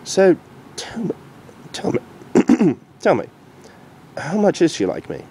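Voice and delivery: stall, then ask; hesitant Doctor. Acted. The Doctor asking Future Derpy, halting.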